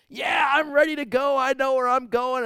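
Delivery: put-on high, loud cheery old patient; a dying man greeting his pastor, cheerful and loud. Dying man to his pastor, loud and cheerful. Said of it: "and he's like".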